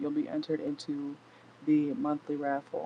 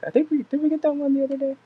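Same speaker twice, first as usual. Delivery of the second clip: excited aside; 10 semitones higher, 6 dB louder, much faster than usual. Real, not acted. Excited aside while reading cards.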